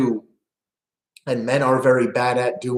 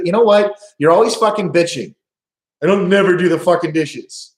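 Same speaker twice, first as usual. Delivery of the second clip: higher, louder defensive blow-up; angry defensive snap; 5 semitones higher, 6 dB louder than usual. Retold or imitated. Husband snaps back at wife over the dishes, angry. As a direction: hit back at the nagging wife. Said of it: "the immediate male response".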